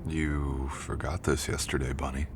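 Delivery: soft, slow and tender; slow soft murmur, fond and teasing; gentle teasing nickname. Acted. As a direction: hand it back with a soft affectionate tease. Handing back forgotten item gently.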